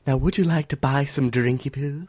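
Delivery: high, loud hissing lizard merchant; lizard woman voice. Pretend. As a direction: sell drinks like a sly lizard woman. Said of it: "I'm lizard woman".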